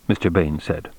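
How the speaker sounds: plain reading voice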